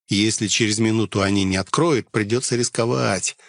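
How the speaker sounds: ordinary delivery, nothing marked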